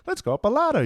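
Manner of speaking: squeaky high, cheery sing-song pawn line; mock cheery pawn. Mocking an annoying game companion, squeaky and high. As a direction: chirp it way up high like an annoying sidekick.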